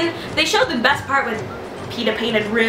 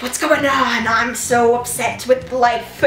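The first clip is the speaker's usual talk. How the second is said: mock dazed Katniss; slower than usual. Acting out Katniss.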